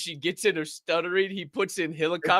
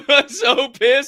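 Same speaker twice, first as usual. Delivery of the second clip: ordinary talk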